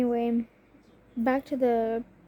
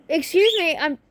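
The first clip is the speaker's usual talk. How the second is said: loud, higher-pitched real interjection; exasperated calling out; 5 semitones higher, 8 dB louder than usual. Real, not acted. Vlogger interrupted at home, exasperated calling out. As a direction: cut in sharply over the disturbance.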